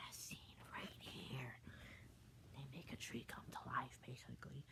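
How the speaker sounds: soft unvoiced whisper; hushed whispered narration; whispered aside. Real, not acted.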